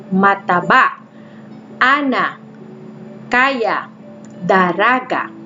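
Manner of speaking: teacher modelling Bikol words; modelled word reading again. Shown as a demonstration. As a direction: read each word clearly for children to copy. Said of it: "I'll read it to you".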